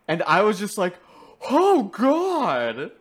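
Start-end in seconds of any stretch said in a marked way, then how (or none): 1.5-2.9 high, loud mock-panicked cry; horrified alarm. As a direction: blurt it out in exaggerated dread. Retelling own horror at baby monster.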